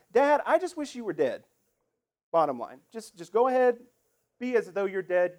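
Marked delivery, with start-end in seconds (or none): 0.1-1.4 louder, higher, cold mock demand from a son; blunt demand. Son telling his father he wishes him dead, bluntly. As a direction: spit it out bluntly, no softening. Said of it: "in no uncertain terms".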